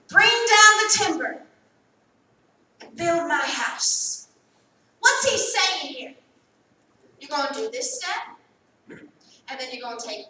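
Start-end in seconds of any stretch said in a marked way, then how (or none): 0.1-4.3 commanding sermon cadence, slowing on build; preaching God's command. Acted. Preacher to congregation, proclaiming God's command. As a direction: proclaim it like the Lord's order. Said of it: "I'm gonna say it till you feel it".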